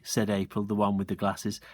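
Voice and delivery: usual speaking voice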